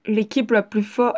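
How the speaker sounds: ordinary talk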